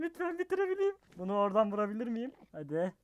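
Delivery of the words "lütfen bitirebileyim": much higher, desperate plea; high pleading. Real, not acted. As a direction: beg in a high voice. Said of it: "bunu sığırlarla hardcore için yapıyorum".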